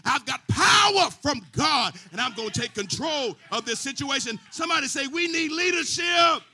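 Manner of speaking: loud, shouted sermon build with the organ; preaching at full force. Acted. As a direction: whip the congregation up, full voice.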